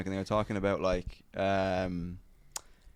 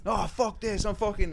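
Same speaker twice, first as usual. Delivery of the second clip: high-pitched retold huff; mock storming off; 11 semitones higher than usual. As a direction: imitate someone storming out in protest. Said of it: "he, like, goes and he storms out".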